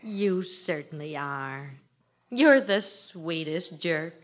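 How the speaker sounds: slow, low and soft; softly forgiving a sweet fool; tender. Acted. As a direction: melt as you forgive him. Wife forgives husband tenderly.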